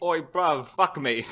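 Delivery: mock British accent.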